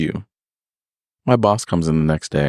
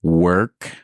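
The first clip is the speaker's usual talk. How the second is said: "work" set apart by pauses, lifted at the end; air quotes. Retold or imitated. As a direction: put "work" in audible quote marks, rising slightly. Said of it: "hear the air quotes".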